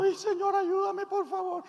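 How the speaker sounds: very high, whimpering little plea; mock pleading. Congregant bringing problems to the altar, mock pleading. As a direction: beg in a squeaky put-on voice.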